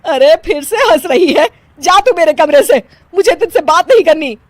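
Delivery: high, loud telling-off; outraged mother-in-law; angry scolding. Acted. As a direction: order her out, thoroughly fed up. Angry mother-in-law sends her away.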